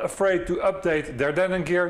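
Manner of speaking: normal talking voice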